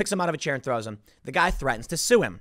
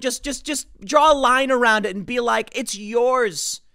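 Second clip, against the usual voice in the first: heated sarcasm; 7 semitones higher, 4 dB louder than usual. Real, not acted. Sarcastic suggestion to Trump.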